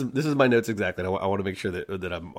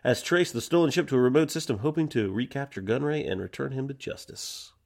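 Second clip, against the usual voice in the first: trailer narration; 4 semitones higher than usual. Acted. Podcast host reading the episode intro, announcer style. Reads episode opening crawl.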